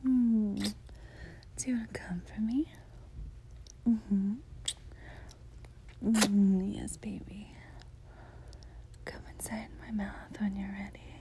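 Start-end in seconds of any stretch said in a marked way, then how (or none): 1.6-2.7 whispering. Acted. Whispers throughout, intimate. 6.0-11.1 whispering. Acted. Whispers throughout, intimate.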